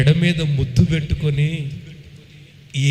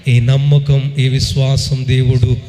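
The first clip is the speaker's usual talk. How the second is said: full-force preaching; 5 dB louder than usual. Acted. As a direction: pronounce the blessing with full power.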